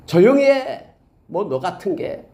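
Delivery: sharp, high scolding snap, then trailing off; scornful hush. Retold or imitated. Crowd scolding the blind beggar, contemptuous snap. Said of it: "이런 거예요".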